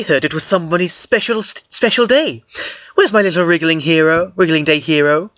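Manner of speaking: high, sing-song doting voice; sweet motherly greeting. Acted. Hemifi greeting the child on his special day, doting and sing-song. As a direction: coo over the little hero like a proud mother.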